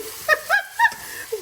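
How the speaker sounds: a real burst of high-pitched laughter; squealing laugh at her own teasing. Real, not acted. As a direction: crack up at the joke.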